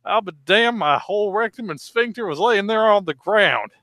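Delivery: high, drawling yokel telling his tale. Acted. Acted old redneck monologue.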